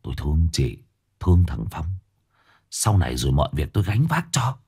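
man's voice gentled, low and soft; softened voice. A narrator's reading. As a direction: soothe her in a low voice. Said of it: "ông Cửu dịu giọng".

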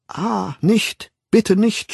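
high, loud pleading cry; schreiendes Flehen. A narrator's reading. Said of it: "schrie sie". (flehend aufschreien)

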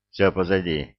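ordinary delivery

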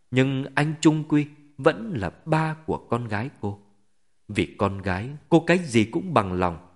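plain reading voice

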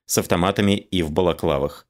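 plain reading voice

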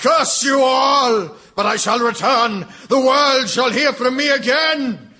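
villain's vow. Acted. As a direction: shake your fist as you exit.

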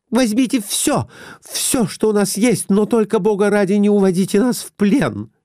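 high, desperate begging; hysterical pleading. A narrator's reading. Wife begs the men not to take them captive, hysterical pleading. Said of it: "по-русски умоляла окружающих её".

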